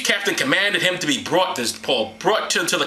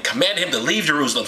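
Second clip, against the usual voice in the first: ordinary talk, nothing marked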